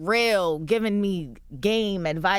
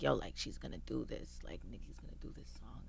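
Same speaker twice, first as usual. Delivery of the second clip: whispering the secret news; hushed whisper; 10 semitones lower, 17 dB quieter than usual. Retold or imitated. Team whispering that [name] will do the song. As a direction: hush it like passing on gossip.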